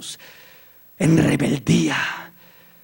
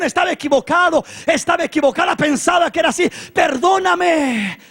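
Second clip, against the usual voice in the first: high, loud plea for forgiveness; impassioned apology; 9 semitones higher, 5 dB louder than usual. Acted. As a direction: cry out like a repentant parent. Acted parent begging children's forgiveness.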